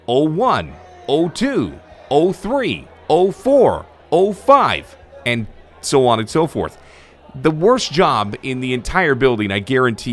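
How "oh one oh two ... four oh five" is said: higher, louder booming rink announcer counting the times; arena announcer call. Shown as a demonstration. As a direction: call each goal time like a PA man. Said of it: "time of the goal".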